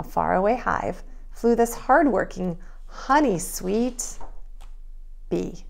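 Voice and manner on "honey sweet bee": ordinary delivery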